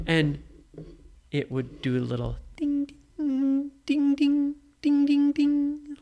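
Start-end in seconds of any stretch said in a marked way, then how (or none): 2.6-6.0 high, loud sung ding ding; bell dings. Shown as a demonstration. Parent chiming the sheep's bell for the child. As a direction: chime it like a little gold bell. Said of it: "like that".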